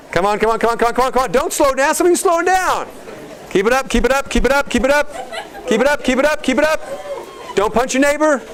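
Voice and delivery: very high-pitched, insistent workout cheering; relentless high-pitched trainer calls; coach's frantic urging. Real, not acted. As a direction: drive them through the last seconds. Coaching exercise, building as time runs.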